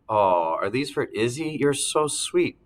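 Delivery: slow, sweetly high, touched; touched mom. Acted. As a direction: melt at the gesture, drawn out. Mom touched by balloon surprise.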